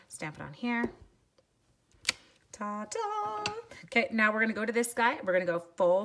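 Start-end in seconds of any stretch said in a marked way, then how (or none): 2.5-3.4 high, drawn-out "ta-da"; singsong reveal. Real, not acted. Crafter revealing the stamped image, singsong flourish. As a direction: sing out a little flourish.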